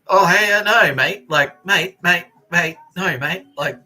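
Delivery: high, loud put-on matey voice; mock matey staff. Clinic staff placating prisoner during blood pressure check, mock matey. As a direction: imitate overfriendly staff, pushing every 'mate'.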